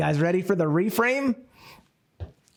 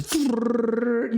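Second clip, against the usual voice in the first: spinning wheel noise; 6 semitones higher than usual. Retold or imitated. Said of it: "Where you spin the thing?".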